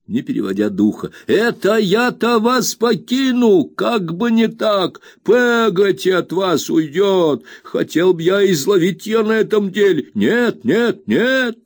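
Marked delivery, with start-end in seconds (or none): 1.3-10.1 put-on high, slow indignant old nurse; indignant Peggotty. A narrator's reading. Peggotty voiced high, repeat of 76. 10.1-11.6 put-on high, insistent no's from an old nurse; exclaiming Peggotty. A narrator's reading. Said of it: "воскликнула Пегати".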